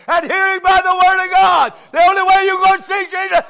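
high, loud revival shouting; full-force preaching. Acted. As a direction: preach it to the rafters.

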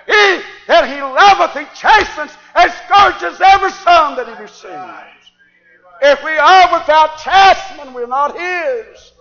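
shouted sermon, pitched high and very loud; full-force preaching. Acted. Preacher warning believers of God's chastening, full-force preaching. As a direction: thunder scripture at the congregation, stretching the big phrases.

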